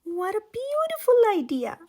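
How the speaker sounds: high, loud and giggling with joy; giggly delight. A narrator's reading. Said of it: "ate her chocolate mouse and giggled".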